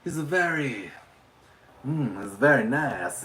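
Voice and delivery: lightly put-on French accent; French-accented character, groping for words. Shown as a demonstration. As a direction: slide into a playful French accent. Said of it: "he sometimes talks with a French accent".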